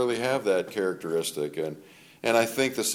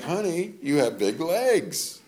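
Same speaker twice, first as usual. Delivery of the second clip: gentle honey; 8 semitones higher, slower than usual. Retold or imitated. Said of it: "And I'm like".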